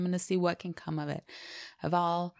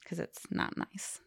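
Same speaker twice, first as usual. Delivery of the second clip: whispering; 8 dB quieter, slower than usual. Real, not acted. Hushed aside after joke.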